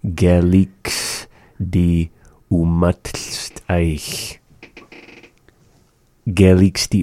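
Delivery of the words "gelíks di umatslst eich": drawn out, very slow model of the phrase. Shown as a demonstration. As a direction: stretch every syllable for the learner. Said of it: "teacher models phrase slowly".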